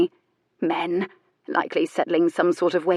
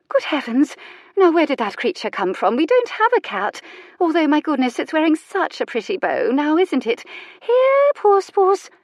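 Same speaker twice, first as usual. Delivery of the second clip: much higher, sing-song, calling a cat; Lady Charlotte calling the strange cat, high coaxing coo; cooing old lady; 10 semitones higher, 4 dB louder than usual. A narrator's reading. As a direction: coax the cat sweetly.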